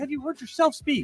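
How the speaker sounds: high-pitched teasing challenge; mocking jab. Host teasing Kelly about his own accent, high mocking. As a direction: needle him with a high mocking question.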